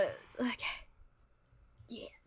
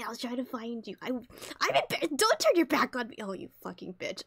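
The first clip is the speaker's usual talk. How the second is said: ordinary talk, nothing marked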